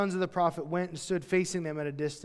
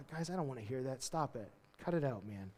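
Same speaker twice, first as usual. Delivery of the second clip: subdued brush-off; 5 semitones lower, 11 dB quieter than usual. Acted. Elisha acted brushing off the prophets.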